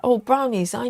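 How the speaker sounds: usual speaking voice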